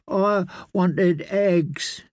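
put-on high, slow whiny child voice; a sulky little lord who wants his eggs; complaining boy. A narrator's reading. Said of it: "he complained".

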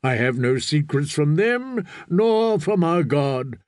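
slow, deliberate priestly declaration; solemn prophet. Acted. Priest defends his drowned men, solemn and slow. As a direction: stretch each phrase with firm conviction.

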